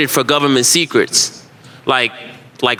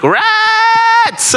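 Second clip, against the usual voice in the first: mock New Yorker cry. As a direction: yelp it like a startled local. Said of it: "You're like".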